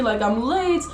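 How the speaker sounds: light Russian accent, mock-annoyed commuter; a touch of Russian accent, griping about being late. Imitating annoyed Moscow commuters in a light Russian accent. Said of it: "my Russian accent, like, actually just came out".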